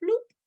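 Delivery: high squeaky bloop sound effect; cartoon bloop noise; playful bloop. Shown as a demonstration. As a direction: pop it out high like a button click. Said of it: "It goes like this".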